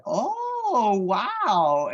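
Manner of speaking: a condescending, sing-song oh wow; mock impressed clerk. Clerk mocking his claim.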